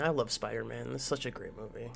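normal talking voice

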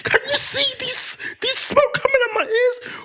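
very high, squeaky mock rage; hammed-up child's tearful tantrum; imitated tantruming child. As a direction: wail slowly like a furious little kid. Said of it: "He goes".

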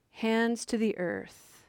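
ordinary talk

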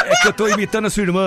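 fast, high, laughing reply; real laughter answering the caller; laughing comeback. Real, not acted. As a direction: laugh through the joke back.

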